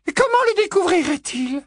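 a mother insisting he stays safe; Mrs Weasley protesting. A narrator's reading. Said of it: "interrogea missus Weasley".

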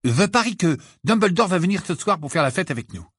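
high, lively announcement; joyful. A narrator's reading. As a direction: throw it out cheerfully. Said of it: "Ron lança joyeusement".

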